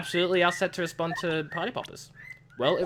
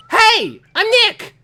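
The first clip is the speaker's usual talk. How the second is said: much louder and higher, shouted at the camera; loud call; 14 semitones higher, 11 dB louder, much slower than usual. Pretend. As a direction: yell it so the mic picks it up. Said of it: "Maybe I'm not saying it loud enough".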